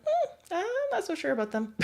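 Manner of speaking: high, unsure voice of a shy dog; hesitant dog voice. Retold or imitated. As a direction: voice the wary dog doubting a stranger. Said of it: "they're kinda like".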